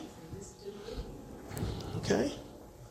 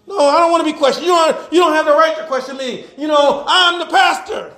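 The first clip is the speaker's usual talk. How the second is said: higher-pitched, self-important mock protest; indignant pastor; 8 semitones higher than usual. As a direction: pull rank on whoever dares question you. Acts out preacher refusing questions.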